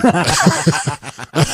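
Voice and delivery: quick amused laugh; short laugh. Real, not acted. Amused by Barkley's stubborn bet, short laugh.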